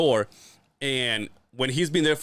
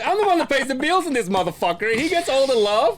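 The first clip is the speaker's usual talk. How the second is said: high, loud comic outrage; hammed-up aggrieved husband; mock jealous protest; 13 semitones higher, 5 dB louder than usual. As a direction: yell it in exaggerated indignation. Acted jealous protest at bird's treatment.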